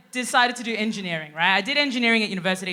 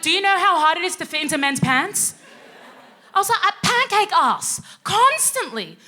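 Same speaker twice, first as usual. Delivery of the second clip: high, building complaint; hammed-up indignant grievance; exasperated mock rant; 6 semitones higher, 4 dB louder than usual. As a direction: rant louder and higher as it goes. Acted rant to boss about pants.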